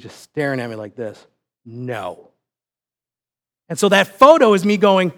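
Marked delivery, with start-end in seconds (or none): 1.7-2.3 low, quiet imitated "no"; stony refusal. Staring son refusing his dad a hug, quiet low refusal. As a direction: refuse under your voice, low and immovable.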